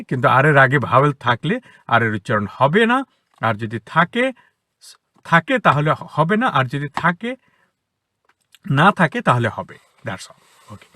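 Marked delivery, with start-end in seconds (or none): none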